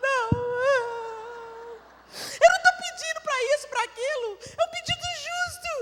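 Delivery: high, loud, tearful pleading; sobbing protest. Retold or imitated. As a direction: cry out to the family through sobs. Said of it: "Gente, eu comecei a chorar, soluçando".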